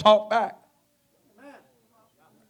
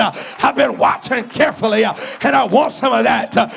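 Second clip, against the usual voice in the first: rising, louder preaching climb; preaching build; 3 semitones higher, 3 dB louder than usual. Acted. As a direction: drive it home to the congregation. Preaching at full force.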